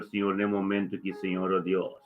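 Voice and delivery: usual speaking voice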